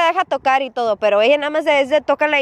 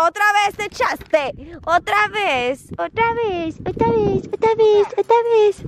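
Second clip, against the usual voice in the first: mock scolding, building; 7 semitones higher than usual. Real, not acted. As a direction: scold the dog with mounting exasperation. Regaña a la perrita, cada vez más. (regaño que sube de tono y volumen)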